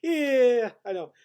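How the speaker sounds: high, loud laughing Italian voice; hearty laugh. Retold or imitated. Server laughing at his own joke, high and loud. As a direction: laugh it up big. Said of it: "the guy goes".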